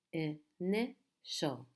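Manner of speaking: "initial" slowly split as i, ni, tial; syllable by syllable. Shown as a demonstration. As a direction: sound out "initial" in three separate beats. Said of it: "How many syllables in this word?".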